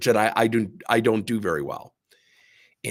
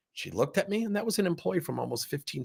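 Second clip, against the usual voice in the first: normal talking voice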